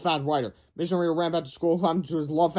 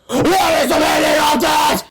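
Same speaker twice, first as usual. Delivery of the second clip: loud, high, slow bellow; furious shout; 12 semitones higher, 9 dB louder, slower than usual. Acted. Teachers shouting at the kids from the window, loud and slow. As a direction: demand it at the kids. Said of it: "they shouted".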